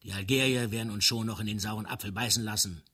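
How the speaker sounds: ordinary delivery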